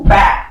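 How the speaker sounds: one loud, sharp "bah"; a single punchy impact noise; imitated punch sound. Storyteller hits the fool, loud imitated punch.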